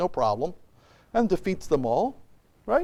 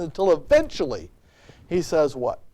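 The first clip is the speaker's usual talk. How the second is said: ordinary talk, nothing marked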